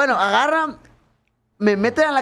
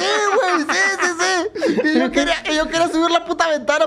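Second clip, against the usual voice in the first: laughing; 7 semitones higher than usual. Real, not acted. Laughing retelling chaos.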